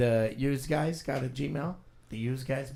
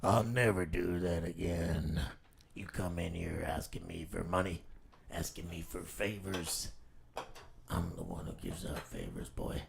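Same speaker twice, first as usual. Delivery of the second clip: lowered, hushed Sin City tough-guy threat; Sin City villain; 6 semitones lower than usual. Acted. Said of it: "Sin City over here".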